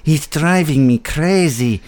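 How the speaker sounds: high, loud pleading impersonation; Peter Lorre. Acted. Host cutting in on co-host, poor high-pitched actor impersonation. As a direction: plead like a hammed-up old film actor. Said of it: "my poor Peter Lorre impersonation".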